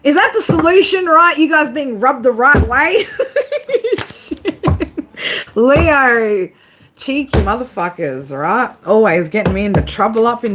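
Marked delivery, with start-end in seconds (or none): 3.1-5.5 high-pitched burst of laughter; big laugh. Real, not acted. Laughing at chat comments.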